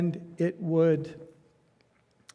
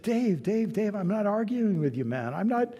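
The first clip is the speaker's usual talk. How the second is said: higher pitched, pleading to calm him down; soothing an angry buddy, voice lifted higher; calming placation; 6 semitones higher than usual. Retold or imitated. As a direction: hands up, easy now, don't fight me.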